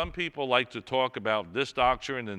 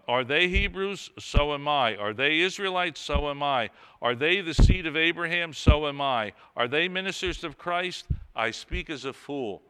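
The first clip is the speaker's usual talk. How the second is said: raised, ringing reading of Paul's questions; proclaimed scripture reading; 3 semitones higher, 6 dB louder than usual. Acted. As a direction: declaim each question to the congregation. Reads Paul aloud in sermon.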